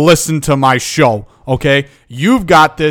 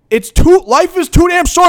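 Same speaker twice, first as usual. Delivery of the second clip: fired-up shout; 13 semitones higher than usual. Real, not acted. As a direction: hammer it home at the top of your voice. Fired-up rant peak.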